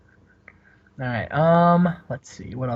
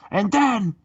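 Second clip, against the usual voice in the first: Scott Steiner promo; 6 semitones higher than usual. Retold or imitated. Host imitates Scott Steiner cutting a promo on the mic, high. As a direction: ham up a strained, high wrestling boast. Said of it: "he says".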